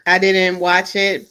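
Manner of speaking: ordinary talk, nothing marked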